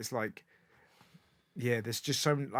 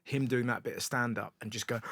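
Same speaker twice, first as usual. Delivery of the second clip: normal talking voice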